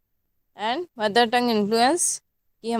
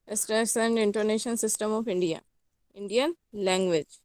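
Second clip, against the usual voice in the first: slow, carefully spaced heading read out; slow dictation; 4 dB quieter, much slower than usual. Acted. Teacher dictating the point heading to students slowly.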